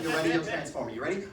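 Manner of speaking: normal talking voice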